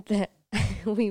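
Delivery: real giggles breaking up the words; laughing while talking. Real, not acted. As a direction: chuckle through recalling a shared joke. Laughing while recalling their joke.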